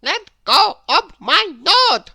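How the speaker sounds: high, loud cry of pain. A narrator's reading. Mister Mischief held by the nose, crying out. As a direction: yelp out while your nose is pinched. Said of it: "cried Mister Mischief again".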